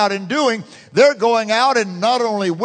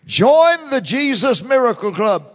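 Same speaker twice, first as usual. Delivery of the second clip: higher and louder, "Join" punched; proclaimed slogan; 4 semitones higher, 4 dB louder than usual. Real, not acted. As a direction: ring out the slogan to the whole church. Repeated slogan, loud take.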